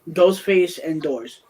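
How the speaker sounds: trailer announcer. Acted.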